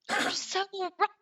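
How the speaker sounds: high-pitched tearful agreement, imitated; retold bawling. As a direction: give in to the friend in a mock wail. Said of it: "like, bawling".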